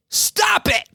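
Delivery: sudden high-pitched yell; shouted catchphrase. Acted. Host's advice for chronic anxiety, sudden high shout. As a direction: blurt it out at full pitch.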